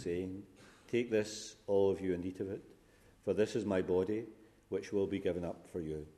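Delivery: ordinary delivery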